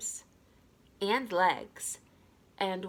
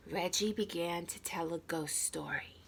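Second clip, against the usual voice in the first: whispered, hushed before the ghost story; hushed narration; 2 semitones lower, 8 dB quieter than usual. A narrator's reading. Narrator whispering to the listener as the ghost story starts, hushed. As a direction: drop to a hush as the story begins.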